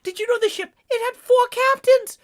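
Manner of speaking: high, fast trivia-gushing sailor voice; excited narrator. Pretend. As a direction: gush ship facts, lightly voiced Ishmael. Rambling Ishmael trivia voice.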